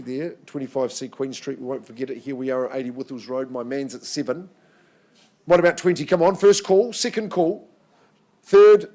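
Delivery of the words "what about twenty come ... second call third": loud, high auctioneer calling out the bids. Real, not acted. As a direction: call it out across the room, building to a shout.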